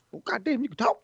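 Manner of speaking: high-pitched mock Georgia accent. Co-host mimics AJ's amazement that giant talks, high Georgia accent. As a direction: exclaim it in an exaggerated Georgia twang. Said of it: "Georgia accent".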